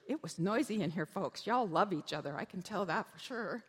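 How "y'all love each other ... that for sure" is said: normal talking voice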